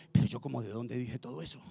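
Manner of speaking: low, soft self-questioning; puzzled aside. Retold or imitated. Preacher stepping off the altar, quietly baffled at himself. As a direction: wonder under your voice, as if offstage. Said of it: "yo me bajo del altar a veces y yo digo".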